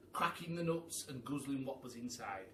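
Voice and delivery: plain reading voice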